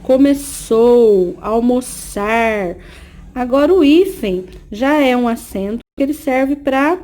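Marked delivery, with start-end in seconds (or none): 0.0-2.8 começou and almoçar very slowly, showing the S sound; slow, careful S sound in começou and almoçar. Shown as a demonstration. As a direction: draw out começou and almoçar around the S of the ç. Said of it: "com o som da letra S".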